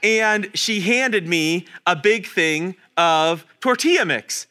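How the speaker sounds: ordinary talk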